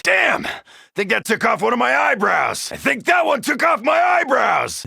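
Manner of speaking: rattled exclamation; startled shout. Acted. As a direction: shout after nearly getting burnt. Singed by a blast.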